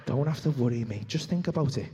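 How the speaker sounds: gently coaxing craving voice; lightly voiced tempter. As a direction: reassure him sneakily, low. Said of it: "He goes".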